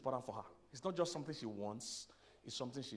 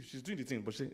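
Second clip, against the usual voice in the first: ordinary delivery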